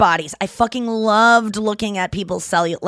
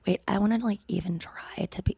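whisper; 10 dB quieter than usual. Real, not acted. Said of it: "whispering her try".

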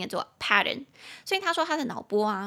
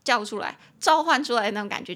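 normal talking voice

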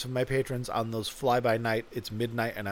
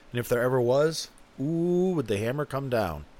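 normal talking voice

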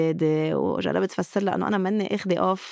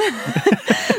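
quick laugh rising up; short high laugh; 10 semitones higher, 4 dB louder than usual. Real, not acted. Laughs at the question. (ضحكة قصيرة)

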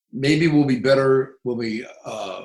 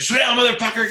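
higher and louder, a mock snarl of a cop losing his cool; mock angry yell; 12 semitones higher, 5 dB louder, faster than usual. Contrasting the calm officer with an agitated cop, mock angry yell. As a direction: fake the officer blowing up, shouting. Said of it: "he never got agitated".

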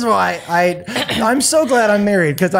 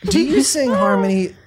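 normal talking voice

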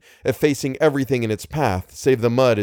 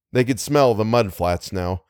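ordinary delivery

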